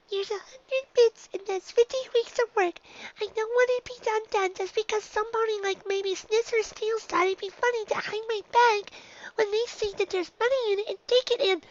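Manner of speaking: put-on high young filly voice, worrying; a nervous little unicorn fretting to herself; high filly voice, anxious and rambling. Dinky frets about her bits.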